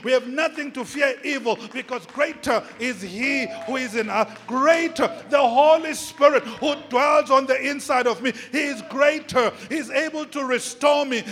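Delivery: building to a high, loud climax; full-force preaching. Real, not acted. Preacher declares to believers the Spirit is greater, fervent climactic preaching.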